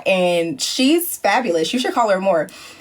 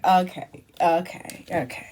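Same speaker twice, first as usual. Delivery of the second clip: resigned producers; 5 semitones lower, 6 dB quieter than usual. Retold or imitated. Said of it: "they were like".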